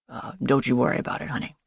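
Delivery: low, quick gargoyle voice; gargoyle barista. A narrator's reading. Gargoyle comforting café regulars, low quick character voice.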